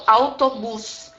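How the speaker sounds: "Autobus" slow, syllable by syllable; pronunciation demo. Shown as a demonstration. Teacher confirming for students how it sounds, slow demonstration. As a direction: say "Autobus" slowly as au-to-bus. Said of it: "é bem assim como eu tô falando".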